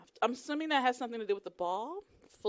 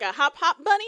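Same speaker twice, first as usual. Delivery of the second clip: louder, higher questioning quote; puzzled quote; 4 semitones higher, 9 dB louder than usual. Retold or imitated. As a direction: ask it like a baffled newcomer. Recounts own puzzled reaction.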